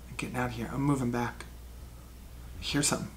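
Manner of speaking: very soft, low in-character murmur; hushed retreat. Acted. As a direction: keep it low so nobody hears. Character retreats from approaching guards.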